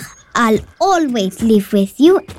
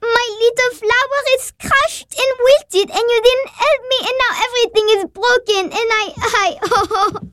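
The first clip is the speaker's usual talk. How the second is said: high, fast tearful wail breaking into sobs; crying; 8 semitones higher, 3 dB quieter than usual. Acted. Child to her sister over a crushed flower, crying. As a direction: wail it out and dissolve into sobbing. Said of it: "Oh, stop crying".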